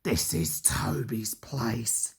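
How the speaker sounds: low, slow, soft grumble; sulky trucks muttering among themselves; grumbling trucks. A narrator's reading. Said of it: "they grumbled".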